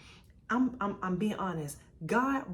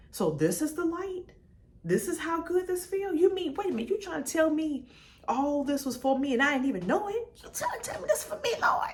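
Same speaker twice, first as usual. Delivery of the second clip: amazed realization; 9 semitones higher than usual. Retold or imitated. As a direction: let the joy break through, climbing higher. Retelling her joyful realisation.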